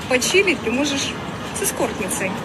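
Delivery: ordinary talk, nothing marked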